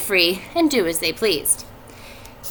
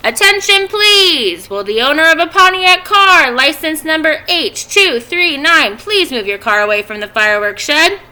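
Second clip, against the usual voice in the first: high, loud announcer call over the fairground; booming like a fair loudspeaker; loudspeaker announcement; 7 semitones higher, 7 dB louder than usual. A narrator's reading. Teacher voicing the fairground loudspeaker, loud announcer. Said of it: "An enormous voice over the loudspeaker said".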